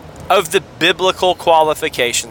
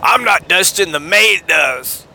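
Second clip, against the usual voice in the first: slow, lofty mock snob; a hint of a Buckhead snob; snooty; 3 semitones higher, slower than usual. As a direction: drag out maid like a rich snob. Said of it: "I sound snooty".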